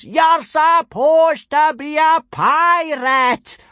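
very high, loud, slow howl; outraged wail at top volume; anguished howl. A narrator's reading. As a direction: howl it in exasperation. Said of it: "howled Gobber".

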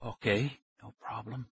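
soft voice fading to a whisper; thin whisper. A narrator's reading. Stranger agreeing to release kids, thin whisper. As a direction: give in very quietly. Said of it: "He had a thin whisper of a voice".

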